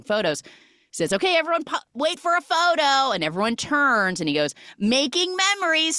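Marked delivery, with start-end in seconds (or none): 1.1-3.1 high-pitched call to a group. Retold or imitated. Photographer halting guests for a photo, calling out. 4.8-6.0 high, drawn-up sing-out; cheery photo call. Retold or imitated. Photographer to guests posing on stairs, calling out cheerily.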